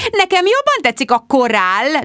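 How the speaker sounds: a pouty, sulky complaint; sulking. A narrator's reading. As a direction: say it like a sulking girl. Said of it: "duzzogott Mary".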